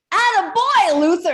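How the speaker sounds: high, booming crackly cheer; very loud crackly shout. Retold or imitated. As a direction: holler it from the crowd in a crackly voice.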